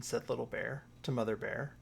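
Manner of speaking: ordinary delivery, nothing marked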